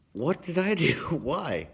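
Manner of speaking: high, loud, startled squeak through a laugh; mock surprise. Told the neighbors are mad, mock shocked. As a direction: act falsely accused, laughing. Retelling his startled reaction.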